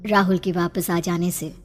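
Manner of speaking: ordinary delivery, nothing marked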